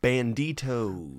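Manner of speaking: put-on slow, stretched Spanish accent. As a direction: say it like a movie bandit with a Spanish accent. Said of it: "that's my Spanish accent".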